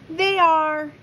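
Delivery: high, bright-voiced girl answer; a pert girl's reply; lightly voiced girl. A narrator's reading. Louisa answers mother, voiced.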